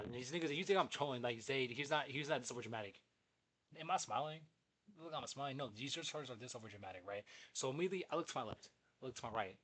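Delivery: normal talking voice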